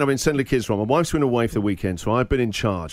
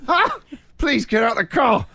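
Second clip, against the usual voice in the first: much higher, strained begging; exasperated plea; 9 semitones higher than usual. Retold or imitated. Dad begs the boyfriend to leave, much higher and strained. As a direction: beg him to just get out.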